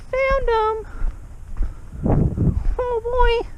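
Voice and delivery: very high squeaky put-on voice; squeaky cartoon voice. Calls out on spotting friends.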